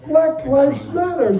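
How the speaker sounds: wife thrilled by the takeout label; delighted reading. Retold or imitated. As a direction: read it like a lovely surprise.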